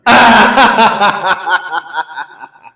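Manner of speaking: loud drawn-out laugh of a rich man who smells his chance; gloating villain laugh. Acted. Rich man laughing at the starving widow, gloating villain laugh.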